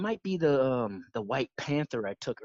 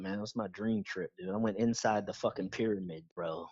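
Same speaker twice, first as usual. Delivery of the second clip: ordinary delivery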